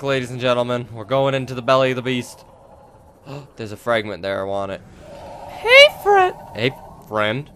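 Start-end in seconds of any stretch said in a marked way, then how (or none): 5.5-6.3 very high, loud falsetto call; squeaky greeting. Pretend. Player calls to a scary creature, squeaky greeting. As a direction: squeal a nervous hello.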